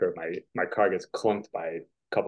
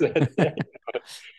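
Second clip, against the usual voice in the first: laughing; 7 semitones higher than usual. Real, not acted. Laughing at his own prank.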